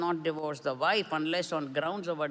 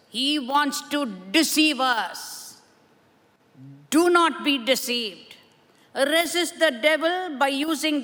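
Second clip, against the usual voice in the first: rising preacher's call, louder; preaching; 6 semitones higher, 7 dB louder than usual. Acted. Preacher urges the congregation to resist the devil, preaching hard. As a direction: drive the message home from the pulpit.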